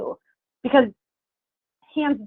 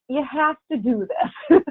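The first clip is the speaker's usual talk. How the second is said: firm and blunt, a little slower; 4 semitones higher, slower than usual. Real, not acted. To her team about the spring clean, bluntly firm. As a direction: tell them straight, no softening. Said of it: "I'm just gonna say it bluntly".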